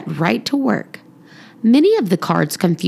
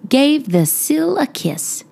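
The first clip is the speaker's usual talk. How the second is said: ordinary delivery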